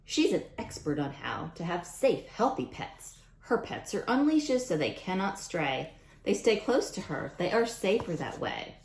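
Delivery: lively storybook read-aloud voice; reading to children. Acted. Teacher reading a rhyming book to kids, storybook voice. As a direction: read it out to the class with bounce.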